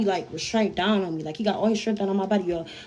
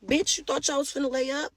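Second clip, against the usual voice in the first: higher-pitched mocking challenge; retold taunt; 5 semitones higher, 5 dB quieter than usual. Attacker to girl in bed, mocking taunt. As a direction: imitate a girl confronting someone.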